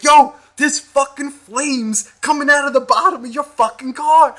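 high, loud urgent warning; retold yell. Truck driver warning about the flames, loud yell. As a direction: call out the danger to the driver. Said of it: "I was yelling at you".

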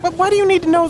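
high, indignant question; exasperated protest. Acted. Customer fed up with the machine, high indignant question. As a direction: push back in disbelief.

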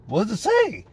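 pitched well up, eager and curious; eager cellmate. Retold or imitated. Excited cellmate asking from the top bunk.

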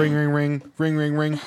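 imitated phone ring. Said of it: "pretend phone ringing".

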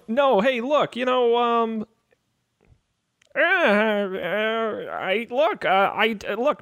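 lightly voiced hesitant guard, stumbling over words; a hint of a flustered underling; reluctant young guard. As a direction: apologetically stall the questioner.

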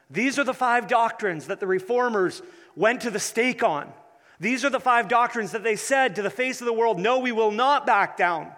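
loud, fervent rally cry; rally the crowd, defiant; preaching at full force. Acted.

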